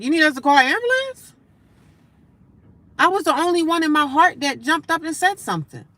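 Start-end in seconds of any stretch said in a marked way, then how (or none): 0.0-1.3 higher, louder corrected offer. Retold or imitated. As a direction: call out the fix with more push. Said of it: "not the police, but".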